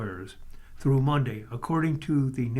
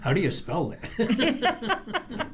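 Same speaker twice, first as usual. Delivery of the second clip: slow, laughing, higher voice; laughing question; 7 semitones higher, much slower than usual. Real, not acted. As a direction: tease through a laugh. Co-host jokes about spelling.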